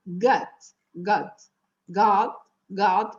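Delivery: "God" with an A sound from the back of the throat; open, unrounded vowel in "God", pulled back; throaty A in "God". Shown as a demonstration. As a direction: say "God" close to "gut". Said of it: "é uma espécie de A".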